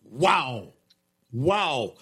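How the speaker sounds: normal talking voice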